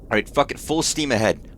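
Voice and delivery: ordinary talk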